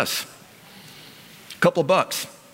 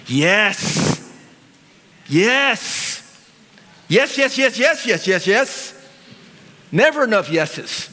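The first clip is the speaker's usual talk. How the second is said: rising joy; 7 semitones higher, slower than usual. Real, not acted. Preacher exulting over God's yes, rising joy. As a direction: build into rapturous yeses.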